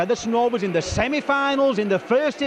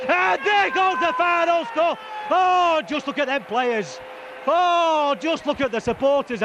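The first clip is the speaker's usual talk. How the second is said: excited; 4 semitones higher than usual. Real, not acted. Final whistle, crowd celebrating.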